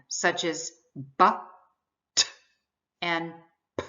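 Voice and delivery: "b", "t", "p" clipped short with no added vowel; quick stop sounds. Shown as a demonstration. Teacher demonstrating stop sounds, short and clean. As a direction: pop each stop sound and cut it off. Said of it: "sounds that you cannot extend".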